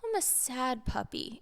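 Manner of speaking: soft, mock-sad little girl; imitated sad child. Six-year-old to mother in a puppy game, softly pouting sadness. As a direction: play a sad puppy, quietly. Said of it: "like, this happy little girl".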